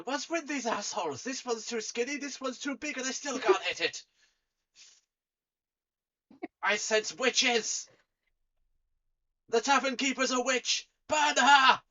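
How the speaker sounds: high, nasal-free goonish thug voice; whiny thug building to a yell; dim thug. Acted.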